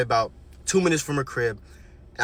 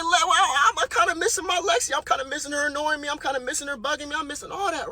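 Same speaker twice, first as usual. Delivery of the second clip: higher, lightly whiny pining; mock wistful whine; 11 semitones higher than usual. Teller voicing himself missing his friend, mock whiny pining. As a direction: pretend to mope, half-joking.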